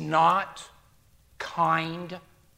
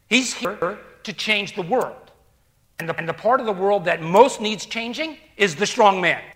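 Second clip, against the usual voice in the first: usual speaking voice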